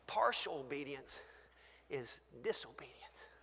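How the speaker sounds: a whispered divine rebuke; hushed voice of the Lord. Retold or imitated. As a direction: whisper it as a solemn verdict. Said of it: "The Lord said".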